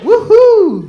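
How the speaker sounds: high, loud whoop of delight. Real, not acted. Comic whoops to the crowd, high and loud. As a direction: let out a big party whoop.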